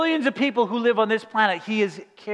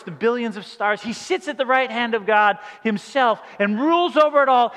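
ordinary talk

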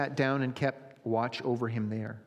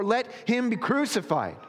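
high, raised shout of a crowd; 9 semitones higher, 4 dB louder than usual. A narrator's reading. Crowd demanding crucifixion of the prisoner, insistent high shout. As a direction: call it out high, insistent to the end. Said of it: "they shouted all the more".

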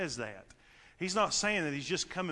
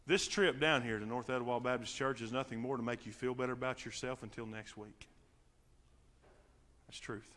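much softer, intimate pulpit plea; hushed sermon appeal; 7 semitones lower, 9 dB quieter than usual. Acted. Preacher quietly urging the congregation to seek salvation. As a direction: lean in and plead softly.

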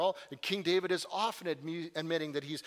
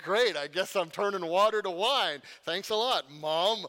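loud, high mock-grumbling thanks; sarcastic Jesus; 4 semitones higher, 7 dB louder than usual. Jesus sarcastically thanking his mother at the wedding, loud and grudging. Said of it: "Jesus is like".

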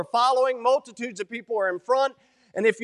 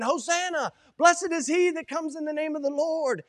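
higher-pitched cry of praise; excited crowd cry; 4 semitones higher than usual. Acted. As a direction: call it out like an excited throng, without much volume. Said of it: "they're making all kind of noise".

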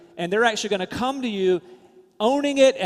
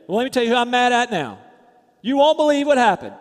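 loud, higher-pitched indignant grumbling; in a huff; 6 semitones higher, 8 dB louder than usual. Acted. Bitter person coming in the door to complain, in a huff. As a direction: act out a huffy complainer, loud and high. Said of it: "in a huff".

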